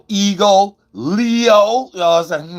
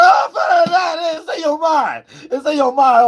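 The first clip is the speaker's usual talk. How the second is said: mock shout; 13 semitones higher than usual. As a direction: yell it to ridicule their fantasy. Mocking shout at Leos.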